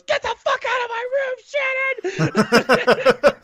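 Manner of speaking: very high mock scream at mom; girl yelling at her mother, hammed-up shriek; teen yell. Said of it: "she turns around and goes".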